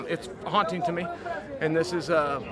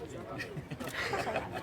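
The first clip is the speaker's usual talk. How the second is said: quiet, breathy laugh; 13 dB quieter than usual. Real, not acted. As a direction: chuckle softly at the banter. Laughs during banter over a bet.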